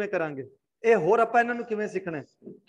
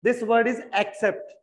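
"accept" read very slowly, every sound spelled out; drawn-out model sentence for the class; much slower than usual. Shown as a demonstration. Teacher slowly models "This word is accept" for students. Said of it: "पहले सी पे आपको क pronounce करना".

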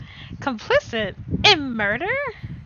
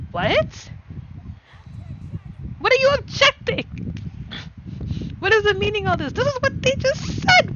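shocked outburst; 10 semitones higher than usual. Acted. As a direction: explode in disbelief at the testimony.

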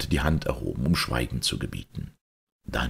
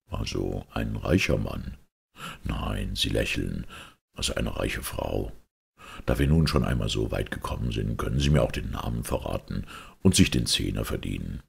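Holmes; 4 semitones lower than usual. Acted. Detective reads the maid's reaction, low and composed.

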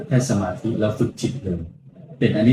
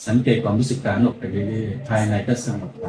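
guided meditation; 2 semitones lower than usual. Acted. Monk leads guided meditation.

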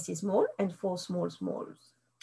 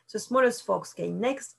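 plain reading voice

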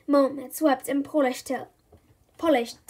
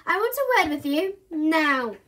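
angry mum; 3 semitones higher, 4 dB louder than usual. Acted.

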